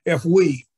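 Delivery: we said with firm emphasis; stress on we. Real, not acted. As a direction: lean on the word we. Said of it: "I stress emphasis on the key word we".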